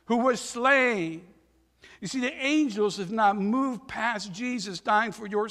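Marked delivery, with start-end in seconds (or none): 0.0-1.3 high, loud and slow, proclaiming to heaven; loud proclamation. Retold or imitated. Angels around the throne praising the Lamb in a loud voice. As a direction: cry it out slowly like a crowd of angels. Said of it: "in a loud voice they were saying".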